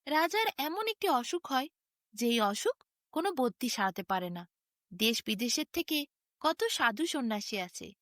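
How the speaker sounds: ordinary delivery, nothing marked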